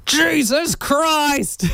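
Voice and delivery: high, drawn-out hammed-up cry; mock losing it. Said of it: "you just see the facade break".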